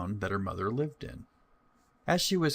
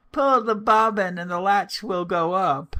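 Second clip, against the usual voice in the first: put-on higher, much louder old woman's call; old grandmother calling out; 10 semitones higher, 7 dB louder than usual. Grandmother calling to the visitor, loud high call. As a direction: call from the bed to the door. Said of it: "called out".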